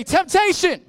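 loud, high shout to be echoed; called-out response. Acted. Pastor shouting the slogan for students to repeat, loud. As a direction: punch RUN and belt the phrase. Leader calls congregation response.

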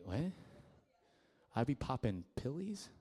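normal talking voice

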